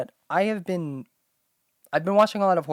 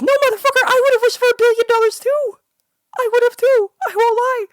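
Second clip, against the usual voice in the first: loud, very high shouted rant; real high-pitched furious shouting; furious high yell; 10 dB louder than usual. Real, not acted. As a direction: yell it at the commenters. Ranting at online commenters.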